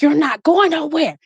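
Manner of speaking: put-on boyfriend threatening.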